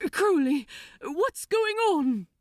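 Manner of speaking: high, loud, frightened plea; an angel terrified for his demon friend. Acted. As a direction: cry out in fear for him. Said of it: "Aziraphale was frightened".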